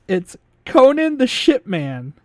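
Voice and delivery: deadpan line reading. Retold or imitated. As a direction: savour the silly name slowly. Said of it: "This is the funniest line".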